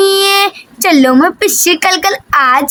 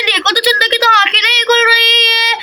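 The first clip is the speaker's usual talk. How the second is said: usual speaking voice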